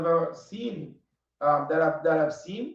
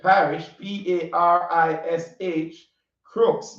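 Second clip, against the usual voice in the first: spelling it out; slower than usual. Shown as a demonstration. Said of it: "my Caribbean accent is a bit thick".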